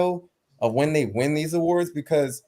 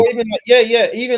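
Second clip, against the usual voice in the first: loud interjection; 7 semitones higher, 6 dB louder, faster than usual. Real, not acted. Cutting in over the argument.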